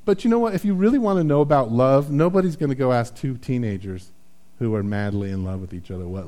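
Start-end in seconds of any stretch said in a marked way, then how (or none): none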